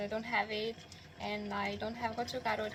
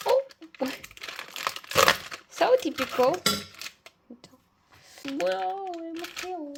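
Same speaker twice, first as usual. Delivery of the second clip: surprised; 7 semitones higher, 9 dB louder, much faster than usual. Real, not acted. Mochi wrapper trouble, exclaiming.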